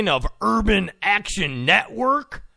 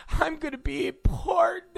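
much higher, softer squeak of glee; mock squeal; 10 semitones higher, 4 dB quieter than usual. Host mocking the reporter, high giddy squeak.

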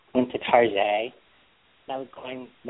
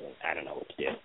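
sudden helpless whisper, much softer; whispered confusion; 8 semitones lower, 13 dB quieter, much faster than usual. Real, not acted. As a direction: drop to a lost little whisper. Confused by car controls, trails off.

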